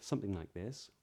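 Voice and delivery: normal talking voice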